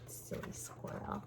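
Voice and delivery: soft whispered aside. Real, not acted.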